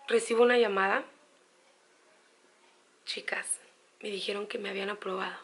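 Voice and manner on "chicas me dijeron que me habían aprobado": softer, whispered then quick and quiet; telling her viewers the news in a soft, quick whisper; hushed reveal. Real, not acted. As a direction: lean in and whisper the good news. Reveals the approval call.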